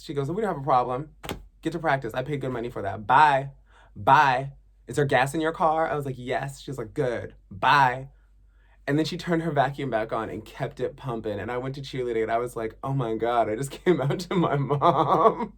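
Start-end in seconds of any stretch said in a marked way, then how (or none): 0.3-8.1 louder no-nonsense mom; brisk mom. Retold or imitated. Mom sending daughter off to cheerleading, louder and brisk. 12.8-15.5 louder, slow huffy complaint with a laugh; in a huff. Retold or imitated. Teen telling teammates about coming out, huffy and louder. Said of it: "in a huff".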